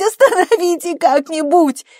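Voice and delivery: very high, loud agonised wail; screaming in pain. A narrator's reading. Bella hit by another contraction, screaming in pain. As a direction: shriek it out in agony. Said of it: "закричала от боли".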